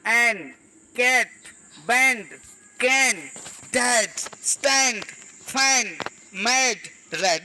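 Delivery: कैट, बैंड, कैन each with an open AE vowel; short A sound. Shown as a demonstration. Teacher showing A also sounds AE, separated word drill. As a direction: drill कैट, डैड, फैन one at a time, lingering on AE. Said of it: "आ भी और ए भी".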